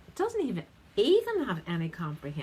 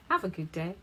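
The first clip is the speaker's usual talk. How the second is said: usual speaking voice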